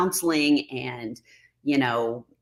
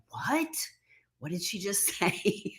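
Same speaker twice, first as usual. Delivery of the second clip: high, hushed mock bewilderment breaking into a laugh; baffled disbelief; 5 semitones higher, 9 dB quieter than usual. Streamer imitating confused viewers reading her jokes, mock-baffled. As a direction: act out a stunned onlooker, high and softly. Acts out puzzled commenters' reaction.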